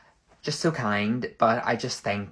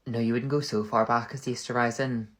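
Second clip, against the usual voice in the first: ordinary delivery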